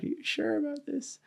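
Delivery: very high, soft voice imitating a mom; worried mom. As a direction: mimic mom's doubt, high and quiet. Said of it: "my mom was just like".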